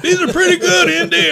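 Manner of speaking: very high, loud New York holler with laughter; gleeful mock New York shout; New York brogue. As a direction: imitate New Yorkers won over, laughing. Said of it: "great New York brogue".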